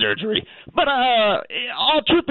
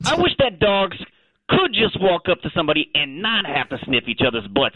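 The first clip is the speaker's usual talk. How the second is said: casual Australian talking dog; chatty Aussie caller, thick Australian accent; 7 semitones higher than usual. Acted.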